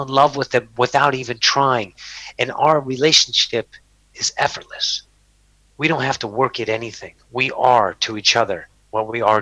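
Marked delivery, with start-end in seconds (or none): 4.1-5.0 whispered softly. Real, not acted. Describing his easy love to the host, whispered. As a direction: let it fall to a whisper.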